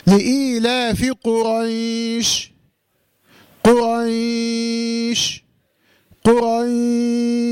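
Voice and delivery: قريش chanted with the "ay" glide held very long on a level note; elongated madd recitation. Shown as a demonstration. Teacher reciting the permitted lengths at a pause, sustained tajweed chant. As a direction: sustain the ي in قريش, then close on sh. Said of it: "يبقى بقول".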